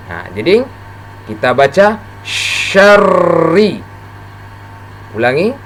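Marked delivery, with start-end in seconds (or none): 2.2-3.8 SYARRI with a spread sh and long rolled r; spread sh, doubled r. Shown as a demonstration. Teacher having learners repeat, spread sh and pressed r in syarri. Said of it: "mulut kita agak".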